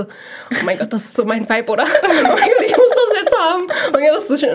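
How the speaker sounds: lightly voiced gushing over something ugly; mock gushing friend. Mocking a friend's gushing while laughing. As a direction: imitate her swooning over a find, rising into laughter. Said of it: "sagt auf Ernst so".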